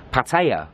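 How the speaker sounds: PATTAYA as pat-AY-uh, TAY in the middle; pronunciation two. Shown as a demonstration. Vlogger showing the vloggers' way, Pattaya as pat-AY-uh. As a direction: say Pattaya with a middle that rhymes with day. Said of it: "Number two".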